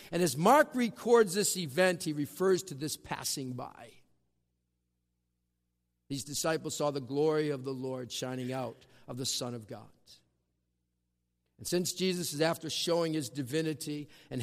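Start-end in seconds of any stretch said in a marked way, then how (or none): none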